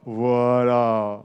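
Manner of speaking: low, drawn-out big voice; big deep voice. Shown as a demonstration. Teacher rounding off the big-A exercise, deep big voice. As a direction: stretch it out low in a fat chest voice. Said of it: "j'ai une grosse voix comme ça".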